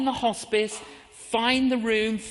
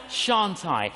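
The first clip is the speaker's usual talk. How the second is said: shan't I, dropped lower; second thought, lower; lower answering doubt. Shown as a demonstration. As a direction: weigh the other side, lower. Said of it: "I get a quiver in my liver".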